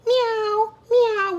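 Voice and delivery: high-pitched cat imitation; cat meow. A narrator's reading. The cat meowing in a farm story, high meows. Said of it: "went the cat".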